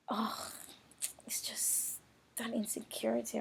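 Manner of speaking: hushed whisper; whispered admission of insecurity. Real, not acted. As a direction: confess it under the breath. Whispered confession.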